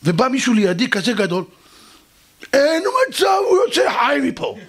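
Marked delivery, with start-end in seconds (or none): 2.5-4.6 high shouted mock threat; threatening big guy. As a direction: rage like a hothead out for blood. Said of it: "ככה הוא עושה לי". (איום בצעקה של בריון)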